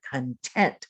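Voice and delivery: Content stressed on the second syllable, kən-TENT, rising. Shown as a demonstration. Teacher repeats Content for learners, stress on TENT. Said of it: "put the accent on the second syllable".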